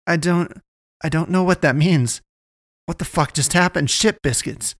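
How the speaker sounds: flustered panic. Acted. Naomi to the Pastress after the attack, flustered high panic. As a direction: gabble it out, overwhelmed.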